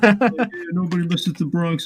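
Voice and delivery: tough Bronx fan. Retold or imitated. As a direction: puff up like a proud Bronx fan. Imitating hyped Bronx fans.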